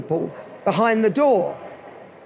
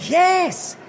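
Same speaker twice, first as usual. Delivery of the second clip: high, drawn-out eager yes; 7 semitones higher than usual. Retold or imitated. Club member jumps at meeting his hero, eager. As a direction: leap up in pitch with delight.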